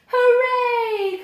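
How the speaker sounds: high, loud hooray; high child's voice cheering hooray; cheering children. Acted. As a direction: cheer it like thrilled kids. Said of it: "cried the children".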